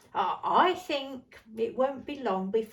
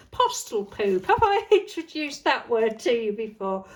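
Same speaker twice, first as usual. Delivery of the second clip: amused, laughing and louder; 7 dB louder than usual. Real, not acted. Admitting a silly word to viewers, laughing. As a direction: say it through a laugh.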